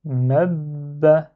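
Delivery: "mab" as joined "mab-ba", lips held shut on the b; "mab" glued into one word, "mabba"; doubled closed b. Shown as a demonstration. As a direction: hold the double b in "mab" without releasing it. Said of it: "je le ferme parce qu'il y a shadda".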